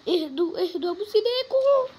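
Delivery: high, loud begging to calm down; desperate plea to teammates; frantic pleading. Real, not acted. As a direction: shout at them to chill out. Teammates panicking in the match.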